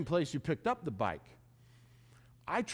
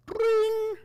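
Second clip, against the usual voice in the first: high, louder bell ring imitation; imitated bike bell; 6 dB louder than usual. On the bike hill, hearing a rider behind, high bright bell ring. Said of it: "I hear, like, a, a bell".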